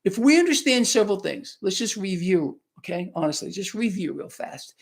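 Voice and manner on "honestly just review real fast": a quick whispered aside; hushed aside to the audience. Real, not acted. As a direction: drop to a whisper for a moment. Aside to viewers.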